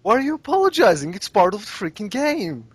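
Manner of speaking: fast, high-pitched incredulous outburst; exasperated protest. Retold or imitated. Viewer telling a player not to apologize, exasperated. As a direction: protest in mock outrage, pitch jumping up.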